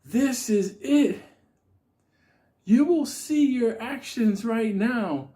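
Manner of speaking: high, very slow, solemn threat; the Unabomber's final warning. Retold or imitated. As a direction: pronounce doom slowly and high. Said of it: "turned around and said".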